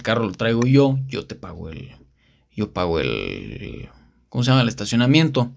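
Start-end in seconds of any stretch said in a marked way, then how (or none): 1.1-3.9 deep mock voice. Insisting on paying parking.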